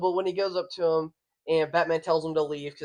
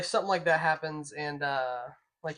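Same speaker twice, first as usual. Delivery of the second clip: usual speaking voice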